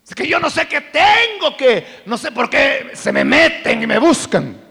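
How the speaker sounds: mock whiny excuse, pitched high and loud; hammed-up shifting the blame; excuse-making. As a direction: boyfriend blaming the other girl, mock whine.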